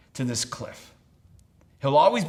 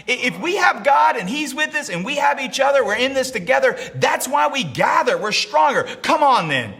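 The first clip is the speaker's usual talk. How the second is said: raised, impassioned sermon voice; full-force preaching; 9 semitones higher, 5 dB louder than usual. Acted. Preacher voicing a man's faith and doubt, full-force preaching. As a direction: preach it out with rising urgency.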